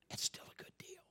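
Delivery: a hushed whispered aside; conspiratorial whisper. Acted. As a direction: lean in and whisper it. Aside within the sermon.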